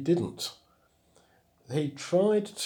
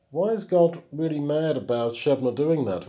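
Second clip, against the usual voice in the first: ordinary talk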